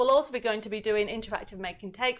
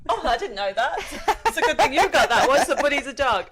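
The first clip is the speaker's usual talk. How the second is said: laughing; 3 semitones higher, 5 dB louder than usual. Real, not acted. Amused by the dog news.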